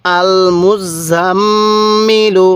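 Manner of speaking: "আল মুজাম্মিলু" very slow, double m hummed long and level; ghunnah. Shown as a demonstration. Teacher modelling the whole word for learners, long nasal hold. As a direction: hold the nasal mm in "আল মুজাম্মিলু", then release into "ilu". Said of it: "কীভাবে পড়ব?".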